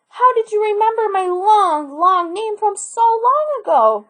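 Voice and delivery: put-on high, excited old woman asking; thrilled granny. Granny leaped up at the news.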